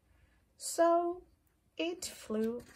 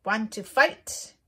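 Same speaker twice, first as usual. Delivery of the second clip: grouchy ladybug voice; 11 dB louder than usual. Acted. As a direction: pick a fight in a squeaky bug voice. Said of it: "said the grouchy ladybug".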